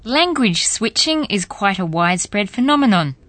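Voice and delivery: ordinary delivery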